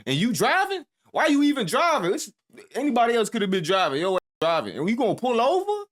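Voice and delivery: high, loud disbelieving questions; incredulous scolding. Acted. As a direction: fire off outraged questions, voice jumping up.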